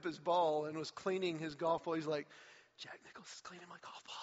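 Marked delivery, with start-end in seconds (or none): 2.8-4.2 hushed, rapid disbelieving whisper; awed whisper. Retold or imitated. Golfer recalling Nicklaus cleaning his ball, in an awed whisper. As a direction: breathe it out, starstruck and secretive. Awestruck golfer retelling a moment.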